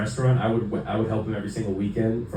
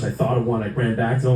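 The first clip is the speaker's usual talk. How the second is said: normal talking voice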